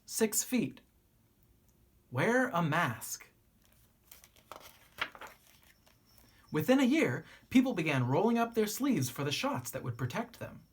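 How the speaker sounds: gentle, expressive picture-book reading; story narration. A narrator's reading. Narrator reading a children's book to young listeners, gently expressive. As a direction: read it like a bedtime story to kids.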